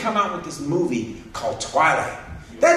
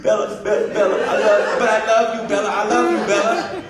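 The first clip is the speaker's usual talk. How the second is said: higher, pleading hammed-up love calls; mock lovesick vampire; 4 semitones higher, 3 dB louder than usual. The pale movie guy pleading to Bella, mock lovesick and higher. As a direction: whine her name like a lovesick teen idol. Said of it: "mocking movie love declaration".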